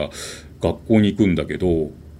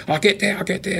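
put-on high, half-sobbed child's cry to open up; crying plea; 12 semitones higher than usual. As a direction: plead like a boy in tears, lightly acted. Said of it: "大泣きに泣いて". (泣きながら扉を叩く少年の軽く演じた懇願)

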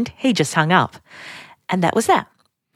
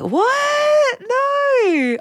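shocked disbelief. Retold or imitated. Hearing brother got engaged.

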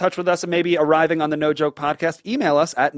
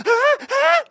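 very high sung squeaks; whistle-note imitation; slower than usual. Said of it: "That's called the whistle range".

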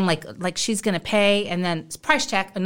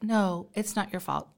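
soft apology; 9 dB quieter than usual. Retold or imitated. Wife apologizing to cashier.

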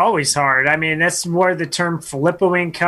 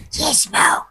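whispered, higher creature voice; gremlin whisper; 10 semitones higher than usual. Acted. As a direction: breathe the name like a tiny gremlin. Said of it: "you're gonna have a voice for this one".

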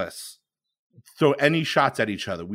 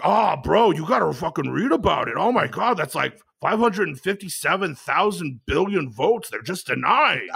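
excited bro voice, pitched up; frat bro; 5 semitones higher than usual. Retold or imitated. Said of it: "He's like".

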